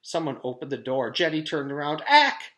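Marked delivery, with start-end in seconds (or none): 2.1-2.5 sharp high loud gasp; startled gasp. A narrator's reading. Jenny startled by a stranger, a sharp high gasp. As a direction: jump at the sound of the door. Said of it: "she gasped".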